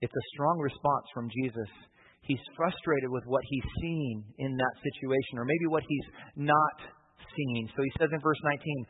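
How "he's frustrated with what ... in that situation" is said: ordinary talk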